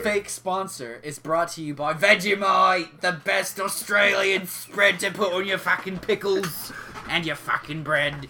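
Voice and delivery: broad Australian pitchman; mock Australian accent. Podcaster reading a fake Vegemite sponsor, mock Australian accent. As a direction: do a hammed-up Australian ad read. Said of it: "say it in an Australian accent".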